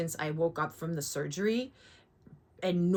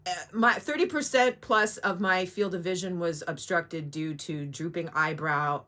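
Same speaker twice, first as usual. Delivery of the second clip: usual speaking voice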